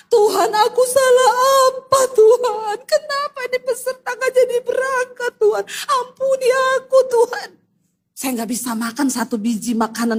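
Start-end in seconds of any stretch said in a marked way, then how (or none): 0.1-7.5 crying out, re-enacted. Praying alone in the hotel, re-enacted tearful wail. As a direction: wail it up high as if pleading with God. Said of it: "Saya nangis teriak".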